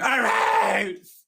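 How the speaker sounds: high taunting yell of her name; sing-song mocking shout. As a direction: jeer it across the club. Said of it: "following me around the club going".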